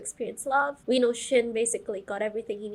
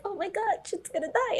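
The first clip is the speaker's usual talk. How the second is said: mock alarm; 9 semitones higher than usual. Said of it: "I was like".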